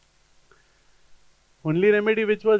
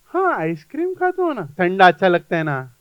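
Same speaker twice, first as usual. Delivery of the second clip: eager patient; 5 dB louder, much faster than usual. Retold or imitated. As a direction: blurt it out like a patient caught out. Patient happily admits liking ice cream.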